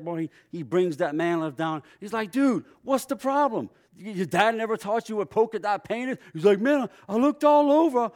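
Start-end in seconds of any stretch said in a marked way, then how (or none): 2.3-6.2 higher, louder mock scolding; fake upset. Crew lead pretending to scold the new hire, mock anger. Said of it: "he just acts like he's getting upset". 6.6-8.1 high, loud, desperate whine; frustrated protest. Retold or imitated. New guy protesting to the crew lead, high frustrated whine.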